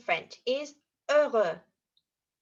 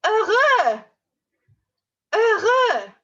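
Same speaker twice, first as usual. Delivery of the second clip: higher and louder, beaming; happy voice; 10 semitones higher, 10 dB louder, slower than usual. Shown as a demonstration. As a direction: sound overjoyed. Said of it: "the action for the word heureux".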